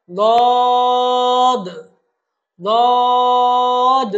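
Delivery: দদ loud, long and level; elongated letter. Shown as a demonstration. Teacher models for repetition, দদ drawn out loudly. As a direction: chant দদ strongly, holding the vowel. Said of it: "বলুন আমার সাথে".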